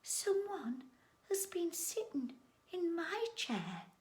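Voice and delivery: soft, high-pitched gentle voice; hushed high mother-bear voice; quiet, gentle Mother Bear. Acted. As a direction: say it gently, almost whispered. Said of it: "said in a quiet, gentle voice".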